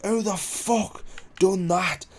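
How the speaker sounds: angry demand. Retold or imitated. Man confronts the lads over the brick, angry demand.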